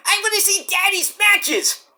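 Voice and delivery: put-on eager little kid. Toddler begging to see daddy wrestle, high and eager. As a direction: beg like a toddler.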